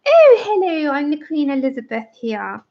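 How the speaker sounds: badly faked royal posh accent, starting high; Queen Elizabeth. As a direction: put on a hammed-up regal English voice. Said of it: "tried to do the Queen".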